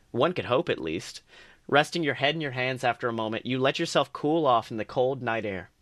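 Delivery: usual speaking voice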